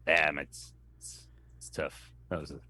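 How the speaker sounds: a low, hushed, mock-sad murmur of a club's passing; mock somber hush. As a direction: drop the voice low and go half-whispered, like mock mourning for the club. Mock mourning a closed club.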